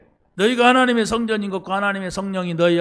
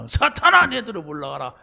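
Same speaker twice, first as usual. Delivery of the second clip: loud, high cry of rebuke; angry rebuke; 3 semitones higher, 3 dB louder than usual. Retold or imitated. Jesus rebuking Peter, a loud high cry. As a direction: hurl the rebuke at him. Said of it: "달라들으니까 예수님이".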